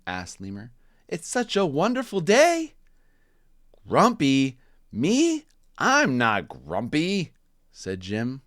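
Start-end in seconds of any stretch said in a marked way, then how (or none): 1.1-2.8 high, cheery animal voice, much higher; a chirpy lemur asking kindly; bright Lemur voice. Acted. Lemur asking Jim, high and cheery. 3.9-7.3 louder, higher denial; grumpy Jim. Acted. Jim denying to Lemur, indignant and loud.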